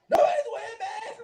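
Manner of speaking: high, laughing mock yell; mock panicky shout. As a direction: squeal it like a scared man. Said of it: "So he's like".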